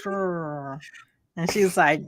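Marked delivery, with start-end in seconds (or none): 0.0-0.8 "sure" with the R held long; failed rolled R. Shown as a demonstration. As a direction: hold the R in "sure" as if attempting a trill. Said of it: "I can't roll my R's".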